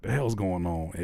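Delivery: ordinary delivery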